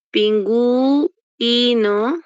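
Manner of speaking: "pingüino" drawn out slowly, the U held and sounded; syllable-by-syllable pingüino. Shown as a demonstration. Teacher showing students the diphthong, slow stretched "pingüino". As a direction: stretch "pingüino" into pin-guu-ino so both U and I sound. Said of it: "está sonando la U y la I".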